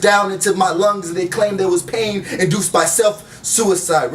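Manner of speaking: rhythmic spoken word delivery, each line landed; poetry slam recital; spoken word poem. Acted. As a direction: perform it like a slam poet on stage.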